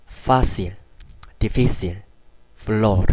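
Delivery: lip-teeth F, lean on the marked syllable; Spanish F demo. Shown as a demonstration. Teacher showing learners the F sound, careful demo. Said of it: "발음을 하는 거죠".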